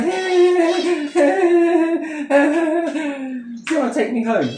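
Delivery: lightly put-on high drunken voice. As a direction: pitch it up as a mock come-on. Mimics drunk woman after a night out.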